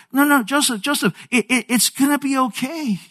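high, stammered plea; pleading reassurance. Retold or imitated. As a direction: imitate a wife urgently calming her husband. Acted Mary pleads with upset Joseph.

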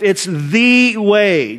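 slow, "the" hit hard as "thee"; stress on THE. Shown as a demonstration. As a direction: punch the word "the" in "It's the way". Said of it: "by using the word "the," it's singular".

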